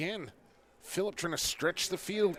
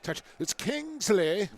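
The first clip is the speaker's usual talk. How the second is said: slow booming announcer call; stadium PA announcer; 5 semitones higher, 4 dB louder, slower than usual. Pretend. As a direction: stretch the name like the PA man. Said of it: "Hyaks P A announcer impression".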